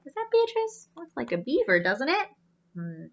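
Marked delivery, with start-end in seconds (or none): none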